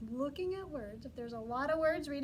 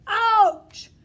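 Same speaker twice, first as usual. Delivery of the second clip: loud yelp; 6 semitones higher, 19 dB louder than usual. Shown as a demonstration. Teacher as the stung bear, yelling loudly. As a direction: yell ouch loud and higher. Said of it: "You gotta use your good voice".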